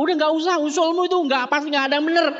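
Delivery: high-pitched, impatient brush-off; mock dismissal. RT meeting rejects a frequent borrower's idea, mock dismissive.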